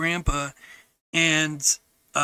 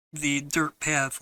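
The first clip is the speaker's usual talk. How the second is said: normal talking voice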